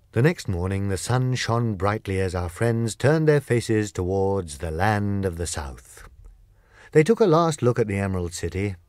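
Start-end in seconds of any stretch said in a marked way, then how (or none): none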